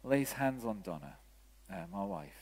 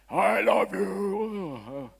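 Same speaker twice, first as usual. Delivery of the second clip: loud, very drawn-out, hammed-up God boom, pitched up; booming heavenly voice; 7 semitones higher, 7 dB louder, much slower than usual. Preacher playing the God character people imagine, in a booming voice. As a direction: stretch it out hugely like a voice from heaven. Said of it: "booming masculine voice from heaven".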